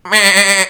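high, loud bleating meh; goat bleat. Pretend. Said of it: "Make that sound".

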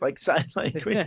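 normal talking voice

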